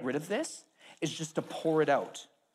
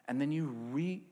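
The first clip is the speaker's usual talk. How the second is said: normal talking voice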